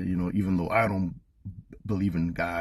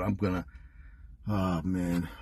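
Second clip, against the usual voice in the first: ordinary talk, nothing marked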